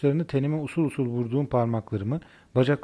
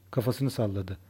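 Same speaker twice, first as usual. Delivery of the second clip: usual speaking voice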